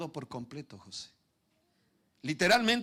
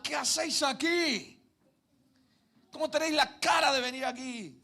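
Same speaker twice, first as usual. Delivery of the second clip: mock indignant Joseph; 11 semitones higher, 4 dB louder than usual. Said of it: "No, dijo".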